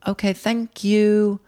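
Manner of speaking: put-on high, drawn-out child voice; two kids chiming a slow thank-you; polite kids. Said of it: "both the kids go".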